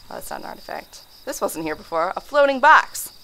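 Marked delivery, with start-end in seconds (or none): none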